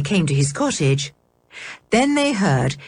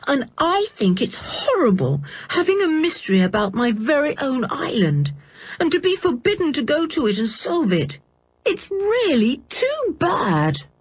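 put-on lightly raised, young girl's voice, sulky and aggrieved; George, upset girl; 9 semitones higher than usual. George complains about the island.

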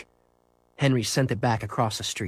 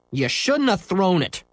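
scowling; 7 semitones higher than usual. Acted. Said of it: "Scooter scowled darkly".

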